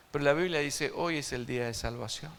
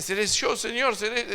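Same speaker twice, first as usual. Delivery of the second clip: high, loud worried disciples asking; mock the anxious disciples, pitched way up; hammed-up fearful Seré yo, Señor; 8 semitones higher, 7 dB louder than usual. Acting disciples asking Jesus.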